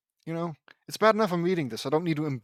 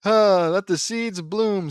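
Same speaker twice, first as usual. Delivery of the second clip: higher, louder slow catchphrase; hammed-up hero cry; loud battle cry; 5 semitones higher, 7 dB louder, slower than usual. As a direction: open on a big huff, then declaim slowly. Acted character battle cry.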